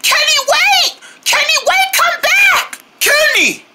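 high-pitched shout after someone; desperate calling. Acted. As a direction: call them back, high and urgent. Calling after departing friend.

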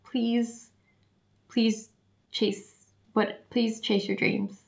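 very soft, halting, choked-up plea; heartfelt plea. Real, not acted. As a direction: beg the fans quietly, with pauses. Emotional plea to her fans.

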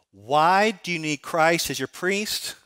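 slow, deliberate quiz question to the crowd; slow catechism question. Shown as a demonstration. Pastor quizzing the congregation, slow and deliberate. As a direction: ask it slowly like a catechism teacher. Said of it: "I'm gonna ask you the question".

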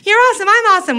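put-on high, gushing fan-girl thrill; gushing excitement. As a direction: gush like it's summer camp. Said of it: "you never meet someone and you think".

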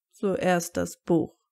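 slow, decided statement; firm. A narrator's reading. Snape sets the order of their errand, firm. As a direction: say it slowly and with resolve. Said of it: "sagte er bestimmt".

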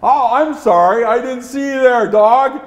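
a touch of startled apology; apologetic surprise. Acted. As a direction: act mildly taken aback and sorry. Leader apologises to the dog.

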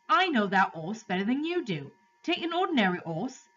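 high-pitched cabby, dropping aitches; urgent cabby. Acted. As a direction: urge them as a cabby who knows horses.

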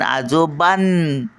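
"आजोबां," slow, ending on a long held "बां"; बां उचलून. Shown as a demonstration. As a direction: stop on the humming nasal of "आजोबां,". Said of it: "बाला आपण उचलून धरतो". ("आजोबां," बां लांबवून थांबा)

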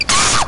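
short, very high shriek; sudden yelp of fright at top pitch; startled scream. Real, not acted. Startled in the game.

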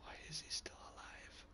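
breathless whispered question; whispering. Real, not acted. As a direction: whisper it in disbelief. Unvoiced whisper.

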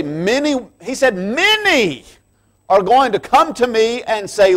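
high, loud impassioned preaching; fervent climax of the sermon; preaching at full force. Acted. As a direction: cry it out to the congregation.

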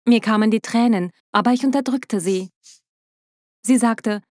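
usual speaking voice

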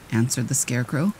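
plain reading voice

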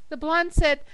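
ordinary delivery

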